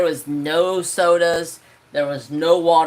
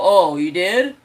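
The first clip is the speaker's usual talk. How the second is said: loud, mocking "you did?"; disbelieving sarcasm; scoffing at auntie's excuse; sarcastic; 5 dB louder than usual. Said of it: "I go".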